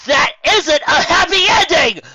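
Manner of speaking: high, loud outraged shout; sudden shout. Acted. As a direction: burst out in protest. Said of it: "Lyra suddenly shouted".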